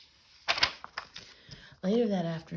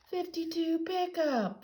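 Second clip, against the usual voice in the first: much higher, louder gleeful yell; scream; 12 semitones higher, 4 dB louder than usual. A narrator's reading. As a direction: shriek the prank. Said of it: "they screamed".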